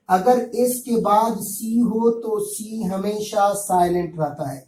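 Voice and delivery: normal talking voice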